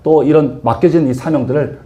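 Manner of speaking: usual speaking voice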